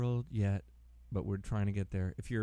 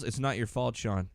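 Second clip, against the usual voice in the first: ordinary talk, nothing marked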